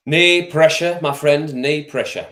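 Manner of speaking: loud, high emphatic knee pressure; emphatic call. Acted. Streamer calls out to Joe, loud and emphatic. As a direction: bellow it like a pundit's catchphrase.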